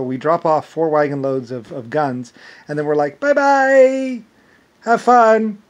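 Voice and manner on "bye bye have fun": high, loud, sing-song goodbye; playfully carefree parting shout; mock cheery farewell. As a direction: call out a breezy send-off, pitched way up. Acts out farewell after dropping guns.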